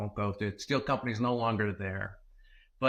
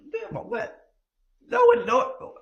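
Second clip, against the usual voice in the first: high, flustered denial through a laugh; mock panicked brother; 7 dB louder, slower than usual. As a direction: play the caught brother squeaking out a denial. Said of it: "My brother's like".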